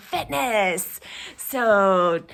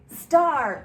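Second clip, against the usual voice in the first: ordinary talk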